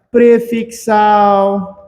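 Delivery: PREFIXAL with very long, slow syllables; drawn-out dictation. Shown as a demonstration. Teacher naming a type of derivation, drawn-out dictation. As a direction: stretch "prefixal" right out. Dictating while writing on board.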